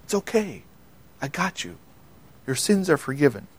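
put-on soft and hushed, like soothing a crying child; gentle, quiet reassurance; tender comfort. Jesus holding the man like a hurting child, softly reassuring.

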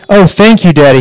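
a lightly voiced happy child calling out; a child thanking his father, mildly excited; excited yell. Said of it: "he yelled".

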